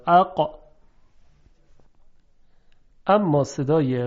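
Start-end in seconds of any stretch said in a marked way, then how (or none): 0.1-0.6 اقا stopped hard at the back of the throat; cut-off qaf. Shown as a demonstration.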